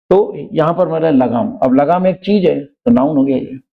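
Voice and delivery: ordinary talk, nothing marked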